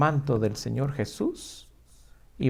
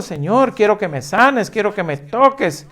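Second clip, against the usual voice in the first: loud, urgent cry to be healed; loud pleading; 6 semitones higher, 8 dB louder than usual. Acted. Preacher voicing the sick woman begging Jesus, loud pleading.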